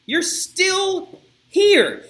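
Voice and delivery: high, loud, very slow mocking call; loud sneering taunt. Guard jeering at the women, high drawn-out loud taunt. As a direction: stretch it out high and loud to mock them.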